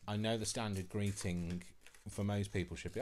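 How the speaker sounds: usual speaking voice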